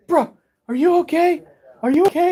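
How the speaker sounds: high, anxious checking on him; a high-pitched brother fussing over him; worried brother. Retold or imitated.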